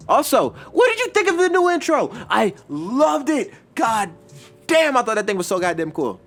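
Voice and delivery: high, loud burst of enthusiasm; over-the-top thrilled, stretching key words; excited gushing. Real, not acted. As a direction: gush about it, pitch leaping way up. Gushes about the new intro.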